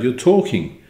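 plain reading voice

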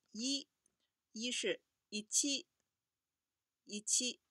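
一七 said slowly as Japanese ichi. Shown as a demonstration. Teacher modelling 一七 as Japanese ichi, slow and clear. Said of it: "我们看一下这些数字的读音".

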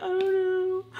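very high mock-helpless whine. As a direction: squeak out a cornered, clueless answer. Acting out helpless answer to husband.

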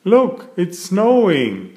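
high, slow wondering call; Daddy Pig excited. A narrator's reading. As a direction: point out the snow in delight. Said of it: "says Daddy Pig".

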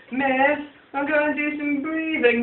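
high, pleading whine; whiny kid. Retold or imitated. Whiny kid asking the teacher for permission, high whining plea. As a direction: beg the teacher like a whiny child. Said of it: "The whiny ones".

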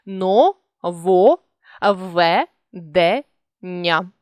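Но-во-ве-де-ня spoken one syllable at a time; teacher dictating syllables; split into syllables. Shown as a demonstration. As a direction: pause between each syllable of Нововведення. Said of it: "не розриваються однакові приголосні".

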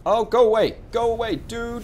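high, loud shooing yell; annoyed shout. Real, not acted. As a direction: yell at the ship to leave. Yelling at enemy ship.

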